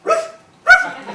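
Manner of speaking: high, loud mock dog bark. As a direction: bark right in the cat's face, hammed up. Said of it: "she jumped right up in the cat's face and she went".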